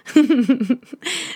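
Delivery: light amused laugh; a quick giggle; short laugh. Real, not acted. Laughs at own tangent.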